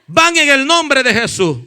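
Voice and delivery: loud, high, relentless sermon shout; preaching at full force. Real, not acted. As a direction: thunder commands against demons at the congregation. (prédica a todo pulmón, con autoridad)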